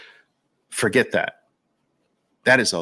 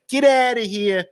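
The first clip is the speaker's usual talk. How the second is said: Brooklyn get outta here; 8 semitones higher than usual. Shown as a demonstration. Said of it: "That's how we used to say it in Brooklyn".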